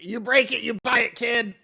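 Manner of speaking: shouted from the back; shouting. Acted. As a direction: yell it across the shop. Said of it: "Rictus is gonna shout from the back".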